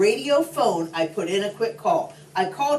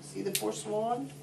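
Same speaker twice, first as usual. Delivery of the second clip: soft aside; 10 dB quieter than usual. Real, not acted. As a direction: quietly point out the picture. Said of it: "aside to the child listener".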